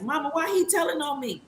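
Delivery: whiny grandkid. Retold or imitated. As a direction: pout it up high like a tattled-on kid. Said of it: "they get like".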